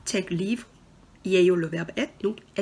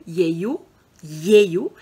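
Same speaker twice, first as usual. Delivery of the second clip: 예요 said firmly, the second louder; stressed ending; 3 semitones lower, 6 dB louder, faster than usual. Shown as a demonstration. Teacher stressing the ending for students, louder. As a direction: hammer home 예요. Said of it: "quand ça finit par une voyelle".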